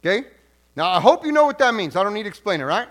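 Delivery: ordinary delivery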